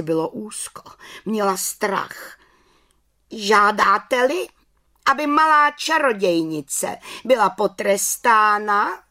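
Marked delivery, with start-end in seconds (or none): 3.3-9.0 formal head witch. Acted. Head witch asks the crowd.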